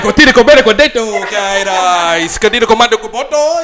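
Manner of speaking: hammed-up Spanish babble, mock accent. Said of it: "I'll be speaking Spanish".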